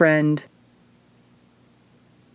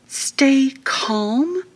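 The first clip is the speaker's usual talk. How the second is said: breathy, high, disbelieving whisper; frightened inner thought, whispered in disbelief; incredulous whisper; 8 semitones higher than usual. A narrator's reading. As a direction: hiss it to yourself in panic. Narrator's panicked inner thought.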